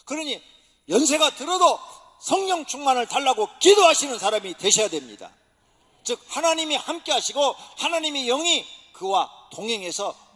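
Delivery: loud, ringing sermon delivery; preaching at full force. Acted. Pastor urging the congregation to seek the Spirit, full-force preaching. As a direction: preach it out to the whole church.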